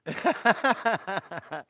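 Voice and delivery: loud high burst of laughter. Real, not acted. Streamer laughing at his own silly voice, loud burst. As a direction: crack up loudly.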